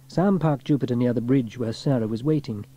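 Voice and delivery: plain reading voice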